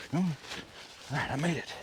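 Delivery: ordinary delivery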